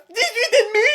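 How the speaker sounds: very high, cracking into a wail; horrified reading. Acted. Son reads out his marks, high horrified wail. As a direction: squeal the mark in horror.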